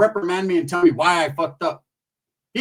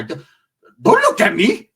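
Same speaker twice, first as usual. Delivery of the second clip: retold protest; 6 dB louder, much slower than usual. Acted denial when confronted.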